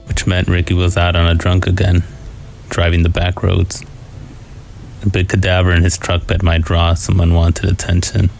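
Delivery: plain reading voice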